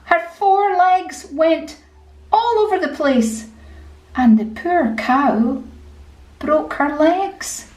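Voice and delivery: ordinary delivery, nothing marked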